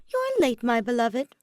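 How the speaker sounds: very high, loud mock-scolding; teasing quip. A narrator's reading. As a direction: scold him playfully, fond and cheeky. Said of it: "he quipped".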